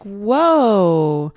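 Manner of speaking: a loud, startled whoa; retold surprise. Shopper realising she's back where she started, startled whoa. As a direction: jolt as if caught off guard. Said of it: "all of a sudden it's like".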